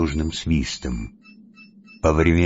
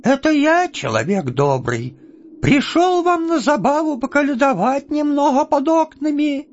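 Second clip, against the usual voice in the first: much higher, put-on voice to hide who he is; disguised voice. Chub disguises his voice before the smith, high falsetto. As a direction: fake a sweet caroler's falsetto. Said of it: "переменив голос".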